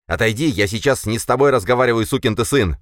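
heated and aggressive; angry order. A narrator's reading. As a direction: order them out of the way. Boris orders narrator aside.

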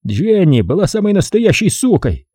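bitter. Acted. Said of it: "с горечью сказал Эдгар".

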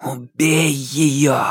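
slow sinister inner hiss; hissing darkness. A narrator's reading. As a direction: hiss it like a tempting demon. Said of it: "прошипела тьма внутри".

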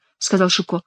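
ordinary delivery, nothing marked

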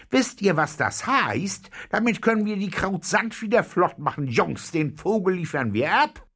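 high, loud, eager outburst; excited calling. A narrator's reading. Captain rallies his boys to hand in the bird, excited shout. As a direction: cry it out to the whole crew. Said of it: "rief der Käpt'n".

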